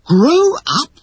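high, loud cry of disbelief; appalled, voice shooting up; horrified. A narrator's reading. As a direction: echo it in utter horror.

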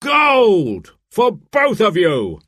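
high, slow excited announcement; sheriff crying out. A narrator's reading. Sheriff announces both golds to the crowd, excited cry. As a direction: call the score out to the whole square. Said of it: "cried the sheriff".

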